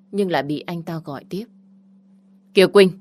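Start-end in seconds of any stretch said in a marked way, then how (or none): 2.5-2.9 louder, higher call across the street. Acted. Ex-boyfriend calls out to her from his car, loud. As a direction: hail her before she leaves.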